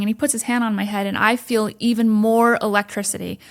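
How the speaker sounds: ordinary talk, nothing marked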